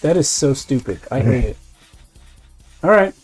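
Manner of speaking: ordinary talk, nothing marked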